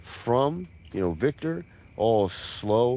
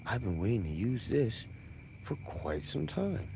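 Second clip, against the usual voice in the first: sinister hush; 9 dB quieter than usual. Retold or imitated. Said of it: "he's like".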